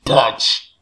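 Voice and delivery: loud distorted demon voice; demonic voice. Pretend. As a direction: growl it like a demon. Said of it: "tu mejor imitación de eso". (voz demoníaca)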